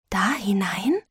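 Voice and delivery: put-on breathless child's whisper. A narrator's reading. Boy whispers to his mother about the coffins. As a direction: whisper it in hushed awe. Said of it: "flüsterte der Knabe".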